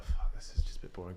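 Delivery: whispered, bored aside under the breath; muttered thought. Retold or imitated. Waiting for bags, a whispered bored mutter. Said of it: "I was just there like".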